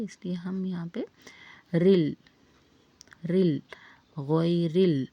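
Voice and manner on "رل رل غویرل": ورل joined smoothly onto غوی, each syllable sounded out; joined recitation. Shown as a demonstration. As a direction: say رل twice, then join it as غویرل. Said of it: "ملا کر پڑھیں گے". (غویرل کو ملا کر واضح تلفظ)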